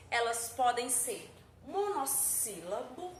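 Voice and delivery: normal talking voice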